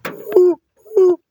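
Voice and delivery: very high, loud hooting owl call. Retold or imitated. Imitating the Tootsie Pop owl hooting loudly. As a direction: hoot it like a cartoon owl. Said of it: "the Tootsie Pop owl would go".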